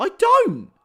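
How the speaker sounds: very high, loud protest; indignant denial. Real, not acted. Streamer denies having a posh voice, indignant. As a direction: squeal the denial at full volume.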